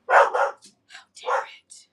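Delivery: slow, drawn-out whispered how dare it; mock outraged whisper. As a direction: hiss it slowly as if scandalised by a passing car. Mock outrage at passing car.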